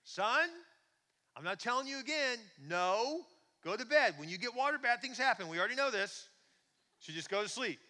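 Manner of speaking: loud, high scolding dad; exasperated yelling. Acted. Father refusing his son's repeated request, exasperated and loud. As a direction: lay down the law up the stairs.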